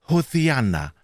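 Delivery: spiritualised saint lisping "Hosianna". A narrator's reading. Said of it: "Dieser lispelte nur".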